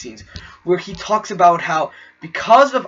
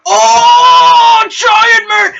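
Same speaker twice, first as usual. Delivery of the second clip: much higher, much louder cheering shout; cheering crowd; 10 dB louder, much slower than usual. Retold or imitated. Theater crowd cheering Giant Man, a much higher, louder shout. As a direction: cheer it like the whole theater erupting. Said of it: "people started cheering".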